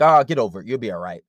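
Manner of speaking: loud, brusque brush-off; a loud dismissive "oh, get over it". Acted. Boyfriend brushing off a crying girlfriend, dismissive. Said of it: "You're not gonna be like".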